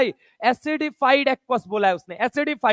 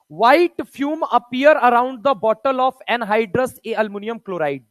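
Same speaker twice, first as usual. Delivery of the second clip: normal talking voice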